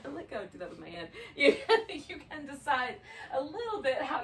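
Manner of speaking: talking through real laughter; amused by her own hand gesture, laughing. Real, not acted. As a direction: giggle at yourself while explaining.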